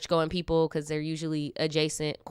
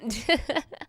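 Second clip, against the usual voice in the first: giggle; 5 semitones higher than usual. Real, not acted. Laughs after teasing.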